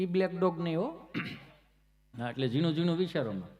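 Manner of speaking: ordinary talk, nothing marked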